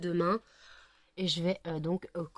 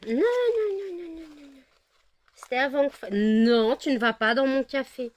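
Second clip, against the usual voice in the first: much higher and louder, alarmed scolding; sharp alarmed no; 7 semitones higher, 8 dB louder than usual. Real, not acted. An owner stops her dog from drinking her coffee in a much higher, louder voice. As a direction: stop the dog before she drinks.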